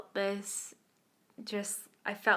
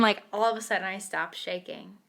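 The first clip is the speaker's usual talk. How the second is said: usual speaking voice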